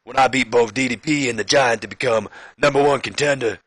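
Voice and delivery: bad impression sliding toward Stone Cold; Booker T. Champion boasting of beating two rivals, badly faked promo voice. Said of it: "This is more becoming Austin".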